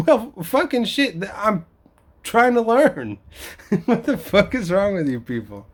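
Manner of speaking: high-pitched laughing exasperation; exasperated outburst. Real, not acted. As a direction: cry out in disbelief.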